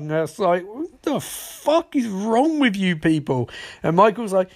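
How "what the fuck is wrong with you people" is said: exasperated outburst. Retold or imitated.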